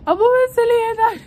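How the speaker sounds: high, loud voice breaking into crying; tearful. Real, not acted. Praising her mother to viewers, voice breaking in tears. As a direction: let the voice crack into tears. Emotional about mom, cry heard.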